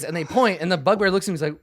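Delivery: usual speaking voice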